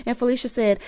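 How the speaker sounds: usual speaking voice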